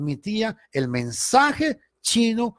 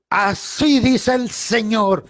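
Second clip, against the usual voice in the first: slow, higher, theatrical prophecy voice; mocking trembling prophet; 6 semitones higher, 4 dB louder, much slower than usual. Mocking false prophets in church, slow hammed-up pronouncement. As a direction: ham up a self-important prophet. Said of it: "y empiezan a temblar".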